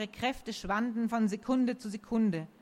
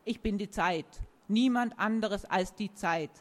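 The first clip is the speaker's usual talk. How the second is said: plain reading voice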